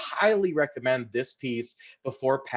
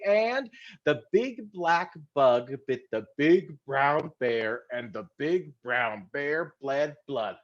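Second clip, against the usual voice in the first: slow, careful tongue twister; slower than usual. Shown as a demonstration. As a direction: go real slow. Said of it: "We're gonna go real slow".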